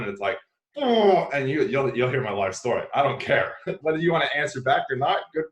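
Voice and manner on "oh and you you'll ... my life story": high loud oh bursting out; mock eager oh. Answering how's it going, about to tell his whole life story. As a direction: launch in like a floodgate.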